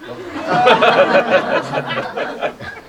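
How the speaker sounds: a long rising unvoiced laugh; helpless giggle at his own mischief; breathy laugh. Real, not acted. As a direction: laugh out at the memory. Remembers taking mom's spoon.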